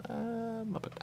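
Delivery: high squeaky puppet voice; a quick Muppet impression; mock Muppet voice. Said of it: "some Muppet says".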